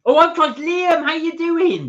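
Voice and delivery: loud, high, slow hello to a viewer; excited greeting. Real, not acted.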